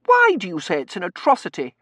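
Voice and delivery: high, loud, affronted question; angry challenge. Acted. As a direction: demand reasons in indignation. Tossie angrily challenges Bain.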